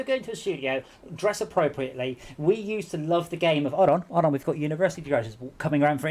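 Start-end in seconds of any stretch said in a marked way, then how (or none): none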